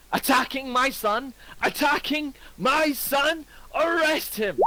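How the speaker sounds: high, shrill-pitched outraged shouting; enraged. Acted. Father screaming about his attacked son, high furious. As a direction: rage at the accused. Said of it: "his temple will start to flare".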